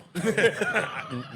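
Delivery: amused laugh. Real, not acted. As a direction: laugh at the punchline. Daughter says mommy not fat.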